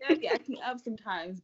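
ordinary talk

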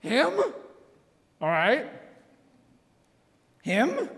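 high, puzzled double-takes; baffled. Retold or imitated. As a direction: ask in total confusion. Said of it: "Peter is like".

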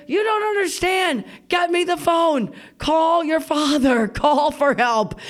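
high, slowing cries for help; hammed-up urgent wail; desperate pleading shout. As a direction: imitate a hurt mother yelling orders. Hurt mother pleading for help.

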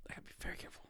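hushed whisper to oneself; cautious aside. Real, not acted. Host wary of what to say next, whispered aside. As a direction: whisper it warily, under the breath.

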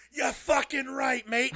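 Tyson Fury shout. Retold or imitated. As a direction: bellow it like a fired-up boxer. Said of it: "I don't know where my accent came from".

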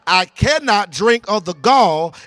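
driving, rousing sermon delivery; full-force preaching. Real, not acted. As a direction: preach it hard and urge them to respond.

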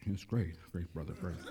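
imitating a limp, unenthused congregation; mock lukewarm reply. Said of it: "And we're saying".

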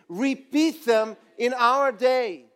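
slow, drawn out for the crowd to join; slow unison line; slow call and response. Shown as a demonstration. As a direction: lead the crowd word by word. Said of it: "Say it with me".

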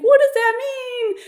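very high, drawn-out mock wail; squealed retold question; alarmed question. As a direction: reenact panicked confusion, loud and slow. Panicked patient reacting to the nurse.

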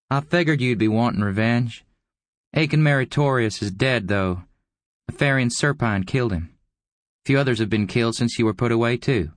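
high-pitched, taunting character voice; needling visitor. A narrator's reading.

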